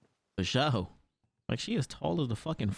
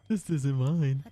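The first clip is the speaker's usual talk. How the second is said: ordinary delivery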